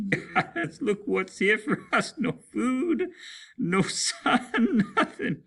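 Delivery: very high, laughing through the words; giggling madly at nothing; hysterical laughing. Acted. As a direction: crack up in despair. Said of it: "Pickard began to laugh quietly".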